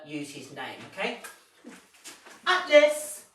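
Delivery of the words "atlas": loud, high call of Atlas; calling the dog. Real, not acted. Trainer calling the puppy by name in a loud high call. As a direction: ring out the name to call him in. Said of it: "I'm gonna actually use his name".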